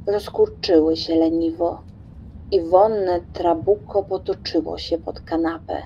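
plain reading voice

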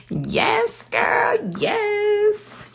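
very high excited squeal; girly high-pitched yes, girl. Pretend. Squealing excited yeses to viewer.

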